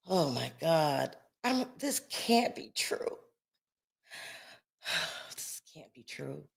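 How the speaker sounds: soft, stunned, fading almost to nothing; hushed disbelief. Real, not acted. Host reacting to shocking news, hushed disbelief. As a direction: let shock take the volume out of it.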